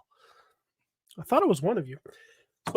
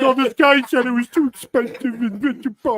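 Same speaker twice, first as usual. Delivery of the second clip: mock whining, pitched up; whiny car salesman; 4 semitones higher, 4 dB louder than usual. Co-host mimicking their friend's texts about customers, whiny. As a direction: imitate a whiny salesman griping. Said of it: "whiny car salesman things".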